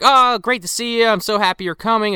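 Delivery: high, lightly hammed-up delight; mock gushing greeting. Friends greeting Emily in retold scene.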